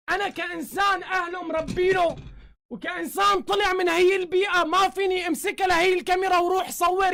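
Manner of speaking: a real furious tirade hurling insults; furious shouting. Real, not acted. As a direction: shout him down in real fury. Furious rant at a YouTuber.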